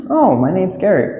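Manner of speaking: high, lilting self-introduction; acted reply. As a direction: play the newcomer with a high "oh". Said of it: "The normal way to reply".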